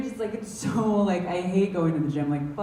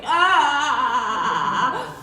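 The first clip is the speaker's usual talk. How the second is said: panicked wail; 4 semitones higher, 5 dB louder than usual. Retold or imitated. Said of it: "I'm like".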